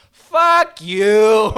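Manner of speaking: huge, high Boston heckler's yell; worst Boston accent. Retold or imitated. Heckling an open-mic comic, loud bad Boston accent. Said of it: "put on my worst Boston accent".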